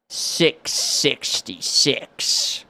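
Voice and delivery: slow, hushed, sinister; ominous whisper. Acted. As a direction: breathe it out like a curse. Ominous run number reveal.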